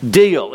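Deal punched loud and falling; stress on Deal. Shown as a demonstration. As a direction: hit the word Deal. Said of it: "punched keyword".

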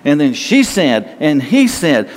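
ordinary delivery

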